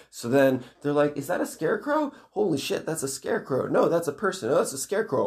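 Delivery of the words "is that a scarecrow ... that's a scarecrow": high, flustered back-and-forth; mock panic. Kids arguing over a scarecrow, high mock panic. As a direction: imitate alarmed kids, pitch jumping up.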